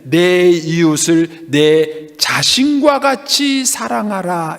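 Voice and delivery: slow and solemn scripture quote; slow quoting of the Lord. Retold or imitated. Pastor slowly quoting Jesus to the congregation, solemn. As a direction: draw out each word of the command. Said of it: "주님은 뭐라 그러냐면".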